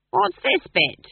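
high, loud demand; loud interruption. A narrator's reading. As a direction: butt in loudly with a question. Granny interrupts the play loudly.

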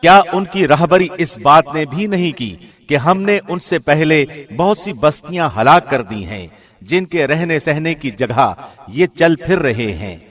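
usual speaking voice

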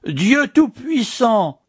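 exclamation très haute et très lente; il s'effondre de stupeur; cri d'effroi. A narrator's reading. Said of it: "s'écria le prieur". (le prieur apprend le sacrifice, cri haut et lent)

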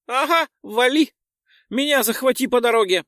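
high loud bossy pixie. Acted. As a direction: order him off squeakily. Pixie calling. (командная пикси)

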